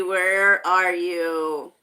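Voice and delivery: slow, stretched-out hunting question; drawn-out searching. Real, not acted. Host hunting for Franklin in the chat list, drawn-out searching. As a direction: draw each word out while searching.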